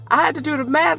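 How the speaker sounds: high, fast incredulous repeat. Real, not acted. Repeats phrase in disbelief.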